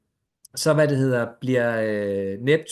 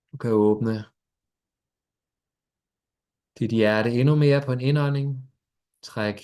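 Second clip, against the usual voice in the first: guided meditation. Acted. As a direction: ease them gently into stillness.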